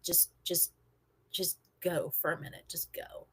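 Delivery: very soft, low, halting; subdued dismissal. Retold or imitated. Doc to the new guy who slept, very quiet and low. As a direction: send them away quietly, fading to almost nothing.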